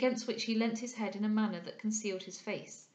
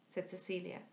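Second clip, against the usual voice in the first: ordinary delivery, nothing marked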